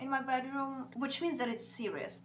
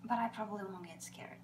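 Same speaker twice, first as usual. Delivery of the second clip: soft unvoiced whisper; 2 semitones lower, 6 dB quieter than usual. Real, not acted. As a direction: whisper it as an aside.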